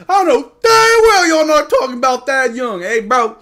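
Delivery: hammed-up indignant yell at chat; mock outrage. Real, not acted. Outraged defense of young player.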